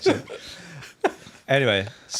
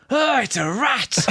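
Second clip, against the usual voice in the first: high, slow, drawn out; mock deadpan; 13 semitones higher, 4 dB louder, slower than usual. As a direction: stretch it out in disbelief. Angler finds a rat.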